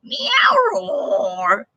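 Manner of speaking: squeaky meow sliding into a roar; meow-roar mix. Shown as a demonstration. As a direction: start as a kitten, end as a lion. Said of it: "a mix of both".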